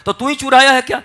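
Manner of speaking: loud, high accusing question; sharp accusation. Retold or imitated. Parent accusing blind son of stealing the coin, sharp accusation. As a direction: demand it like an angry parent.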